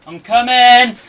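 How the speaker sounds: loud call across the house. Real, not acted. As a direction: shout it to someone downstairs. Calling out to someone downstairs.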